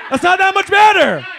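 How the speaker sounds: comic shouting at a fan's answer; mock shout. Shown as a demonstration. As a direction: yell it in mock disbelief. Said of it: "repeated shouted".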